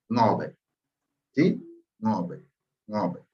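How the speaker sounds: "Ngobe" with a nasal NG and O; correct pronunciation. Shown as a demonstration. Teacher giving students the correct name of the people. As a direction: give the correct name calmly. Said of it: "cuál sería la forma correcta".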